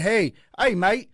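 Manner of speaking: a hint of a bad Swedish accent that comes out Aussie; lightly mock foreign pub guy; fake Swedish accent. Guessing how a Swedish pub guy greets him, lightly put on. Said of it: "I don't know Swedish accents".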